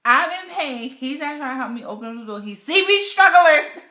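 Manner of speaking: loud, exasperated complaint in pain; fed-up loud rant about the door; angry retelling. Retold or imitated. Wife retells labour pain frustration.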